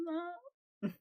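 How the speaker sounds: high sung note that cracks. Real, not acted. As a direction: let the voice break on the note. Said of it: "that was like a fucking voice crack and a half".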